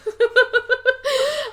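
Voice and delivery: sudden high laugh bursting out; high burst of laughter. Real, not acted. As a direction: laugh out at the joke, caught off guard. Laughs at co-host's remark.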